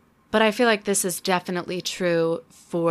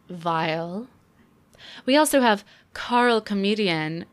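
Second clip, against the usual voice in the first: usual speaking voice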